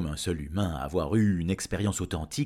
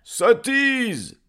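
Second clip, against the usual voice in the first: high, loud heckle; indignant crowd shout; 11 dB louder than usual. Acted. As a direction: cry out as an outraged villager. Villagers reject the offer. (cri indigné de villageois, aigu et fort)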